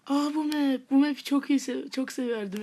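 loud, high burst of excitement; excited delight. Real, not acted. Player recognising a once-loved map, excited.

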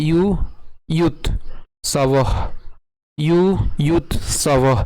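ইউ, ইয়ুথ, সবহ piece by piece with pauses; broken-down pronunciation. Shown as a demonstration. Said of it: "খণ্ডত পর্যন্ত উচ্চারণ করলাম".

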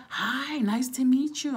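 high, loud mock-friendly hello; gushing greeting. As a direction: greet a stranger with hammed-up cheer. Acted stranger's friendly greeting.

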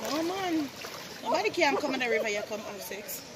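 usual speaking voice

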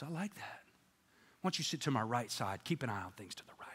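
low, very quiet approval; voice of God. Acted. God seating the Doberman at his right, low and quiet. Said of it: "Guy goes".

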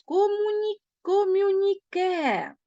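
комюніке stretched slowly, syllable by syllable; teacher spelling out комюніке for transcription; drawn-out dictation. Shown as a demonstration. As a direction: say комюніке slowly and clearly so pupils can write it. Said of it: "ставимо обов'язково апостроф".

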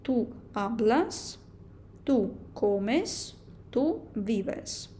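slow, careful Spanish forms for learners; teacher reading verb endings slowly to the class; slow model reading. Shown as a demonstration. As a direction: spell each form out slowly like a teacher. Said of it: "особа «ту»".

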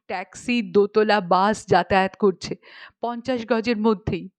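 usual speaking voice

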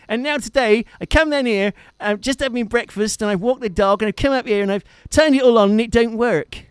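put-on a mate phoning in a Devon accent; Phil's Devonshire accent. Imitating Phil ringing him, Devon accent. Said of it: "in that typical Devonshire".

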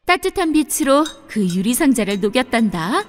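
usual speaking voice

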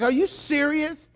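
high-pitched mock disbelief; incredulous husband. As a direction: ask it like a dad who can't believe it. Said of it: "It's like".

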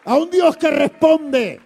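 slow, very loud, high preacher's cry; full-force pulpit proclamation; preaching shout. Acted. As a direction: drag it out and thunder it.

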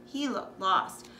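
usual speaking voice

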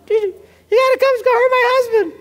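very high, rushed panicked wife; squeaky, hammed-up emergency call; mock panic. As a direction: imitate a frantic wife calling police. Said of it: "She panics, she calls the police".

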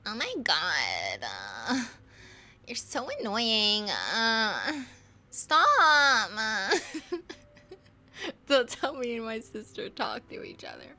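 slow, creaky, whiny drawl that breaks into laughing; fry the words out like a bored valley girl; valley girl with vocal fry. Acted. Doing a valley girl for her viewers, with heavy vocal fry. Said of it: "Just get your vocal fry way up".

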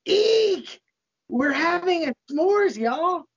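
high, excited Satan squeal; high-pitched, over-the-top delight; gleeful squeal. Acted. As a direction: squeal it like a giddy party host. Satan gleefully announces s'mores in story.

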